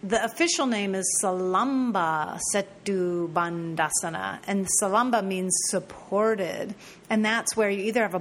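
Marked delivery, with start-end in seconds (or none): none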